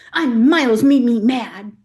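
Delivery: put-on slow, loud pouty kid outburst; mad toddler. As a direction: imitate an angry little girl, drawn out and loud.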